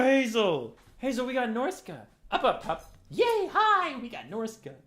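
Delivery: high, loud chirpy voice to the dog; excited dog talk. Real, not acted. Owner telling his dog Hazel the good news, high excited dog talk. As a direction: rouse the pup happily.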